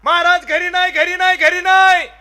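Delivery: high, loud answer from within; loud shout from inside. Retold or imitated. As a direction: shout back from inside the house. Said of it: "आतून मोठ्याने आवाज आला".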